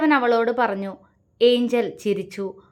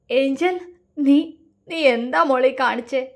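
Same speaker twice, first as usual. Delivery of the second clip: breaking into sobs; 3 semitones higher than usual. A narrator's reading. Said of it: "Shiva പൊട്ടിക്കരഞ്ഞു".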